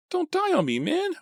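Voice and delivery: very high anguished plea; high begging moan; desperate moan. A narrator's reading. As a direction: moan it out in despair. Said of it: "Artie moaned".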